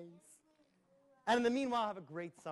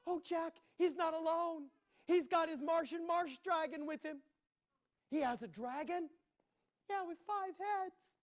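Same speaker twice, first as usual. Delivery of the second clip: higher, breathless princess; 10 semitones higher than usual. Acted. Princess telling Jack about the dragon, high and worried. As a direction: play the frightened princess.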